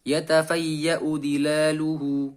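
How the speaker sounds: slow "yatafayya'u dilaluhu" with a short, light hamzah; short when joined. Shown as a demonstration. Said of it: "dibaca pendek". (hamzah "yatafayya'u" dibaca pendek saat wasol)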